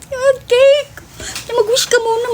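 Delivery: gushing party cheer; excited friend. Retold or imitated. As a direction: voice the friends thrilled over the cake. Friends presenting cake excitedly.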